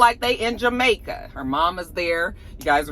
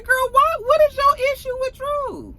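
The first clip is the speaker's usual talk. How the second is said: high, loud incredulous demand; 4 dB louder than usual. Real, not acted.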